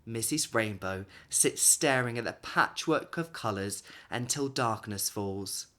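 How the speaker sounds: usual speaking voice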